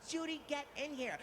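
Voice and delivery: high-pitched excited call; urgent excited summons. Retold or imitated. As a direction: call a colleague in to see a star. Calling a receptionist over.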